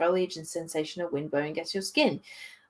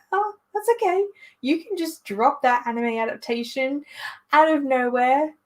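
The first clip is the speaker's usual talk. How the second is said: high, sing-song pretend okay; put-on breezy acceptance; mock nonchalance; 8 semitones higher than usual. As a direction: airily pretend it's no bother, pitched up high. Mock reply to surprise announcements.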